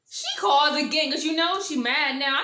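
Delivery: loud, high burst of gossip; excited storytelling. Real, not acted. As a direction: burst out with the juicy update. Excited retelling of the second call.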